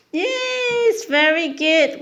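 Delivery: high-pitched yes; excited praise. Real, not acted. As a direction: cheer the little one's answer. Praising toddler's answer.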